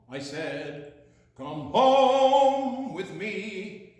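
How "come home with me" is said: loud, slow, drawn-out call; loud slow singsong calling; calling loudly. Acted. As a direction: call them home at full volume.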